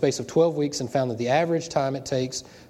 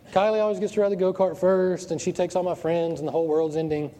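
higher-pitched mock whine of a crying kid; imitated upset child; 4 semitones higher than usual. Dad imitating his crying daughter's complaint about the go-kart, mock upset. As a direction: voice a daughter's tearful complaint, hammed-up. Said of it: "she's just emotional and crying".

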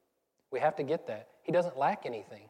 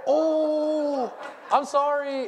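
high, loud embarrassed "oh, I'm sorry"; startled apology; 13 semitones higher, 8 dB louder, much slower than usual. Retold or imitated. Pastor apologizing to a stranger's kid, high and flustered. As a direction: yelp it out, mortified. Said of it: "and I was like".

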